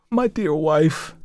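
slow, high, plaintive endearment; tender. A narrator's reading. Dying husband addresses his wife, slow and tender. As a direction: draw it out fondly, pitched high. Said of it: "with more tenderness than he had exhibited".

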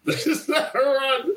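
high, drawn-out repeat through laughter; laughing incredulity. Retold or imitated. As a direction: crack up while stretching out the line. Laughing repeat of same line.

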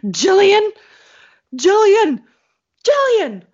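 high, loud repeated calling of a name; mother frantically calling her daughter over FaceTime, high and loud; frantic calling. Retold or imitated. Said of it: "she's like".

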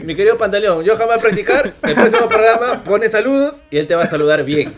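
laughing. Real, not acted. Mock promise, teasing the host.

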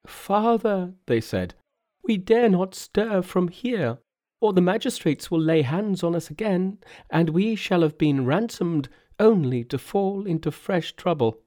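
high-pitched, pleading monks; worried Buddhist priests. Acted. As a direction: plead high as anxious followers to their protector. Frightened Buddhists answer Monkey.

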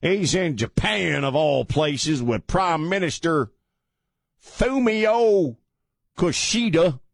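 high, slow, hammed-up announcement; mock-grand announcement. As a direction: stretch the place and names out theatrically. Exaggerated redo after dropping accent.

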